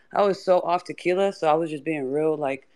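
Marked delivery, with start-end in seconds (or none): none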